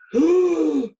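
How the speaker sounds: sharp, high mock gasp; big sudden intake of alarm; anxious gasp. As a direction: gasp loudly at a dreadful thought. Acting out anxious superstitious thought.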